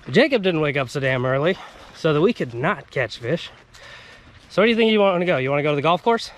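ordinary talk

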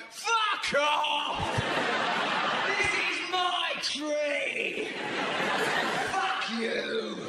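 drawn-out mock territorial yell; aggressive bird shouting. Birds singing to claim their territory, aggressive mock shouting. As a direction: shout it like a bird defending its patch, stretched out. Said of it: "aggressive shouting".

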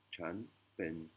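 "chan pen" said softly with a falling tone on "chan"; Thai phrase demo. Shown as a demonstration. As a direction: model "chan pen" quietly, clearly separated. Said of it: "on dira".

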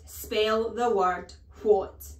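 slow, loud and spelled out; slow menace. Acted. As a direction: hammer each word slowly. Trunchbull drilling the word.

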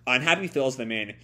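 ordinary talk, nothing marked

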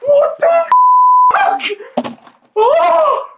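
very high loud shrieks; shocked shouting. Real, not acted. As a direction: yell in cold disbelief. No ice, freezing shock.

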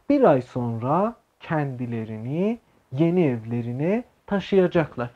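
slow, careful Turkish sentence read for students. Shown as a demonstration. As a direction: read it out slowly, word by word. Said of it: "ببینید جمله چقدر عجیب غریب". (خواندن آهسته و شمرده)

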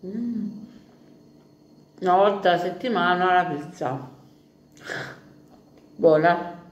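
talking while chewing; biting food mid-sentence; mouth full of pizza. Real, not acted. Seen 1: Holds pizza at mouth, bites and chews while speaking.

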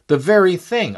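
the second time, burst out with delight; excited repeat. Retold or imitated. Repeated exclamation, second louder.

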